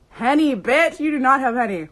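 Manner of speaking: loud, high-pitched mock outrage; playful disbelief. Real, not acted. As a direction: exclaim it, teasing a friend. Playful disbelief at a viewer's drink.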